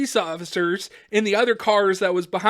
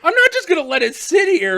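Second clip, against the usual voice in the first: high, loud pleading; imitated indignant whine; mock protest; 9 semitones higher, 6 dB louder than usual. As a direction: argue desperately for a prized tool. Retold protest to cop.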